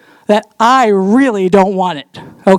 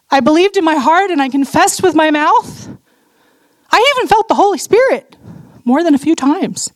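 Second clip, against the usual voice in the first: high, pleading cry to the Lord; 8 semitones higher than usual. Retold or imitated. Desperate woman crying out to the Lord, high and pleading. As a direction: plead with God, pitched well up. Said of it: "I just cried out to him".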